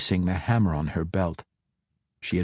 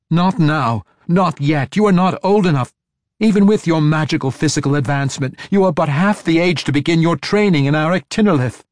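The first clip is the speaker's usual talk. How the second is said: higher, louder, fast scolding; furious tirade; 7 semitones higher, 6 dB louder than usual. A narrator's reading. Matron mother scolds the girl, fast furious shouting. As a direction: berate her without pause. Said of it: "Quenthel demanded".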